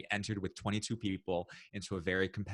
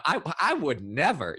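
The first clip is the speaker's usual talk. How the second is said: mock indignant; 8 semitones higher, 8 dB louder than usual. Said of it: "You know".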